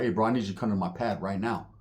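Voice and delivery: low, soft, grave summons; super serious. Retold or imitated. Homie phoning from his pad, super serious. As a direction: say it low with no joking at all. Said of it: "he was being super serious".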